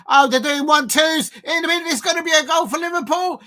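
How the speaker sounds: jumps high and loud as the attack builds; excited live yell; rising excitement. Real, not acted. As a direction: call the danger before it happens.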